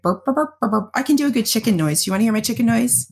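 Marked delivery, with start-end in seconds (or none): 0.0-0.9 imitated chick burps. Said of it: "He was just like".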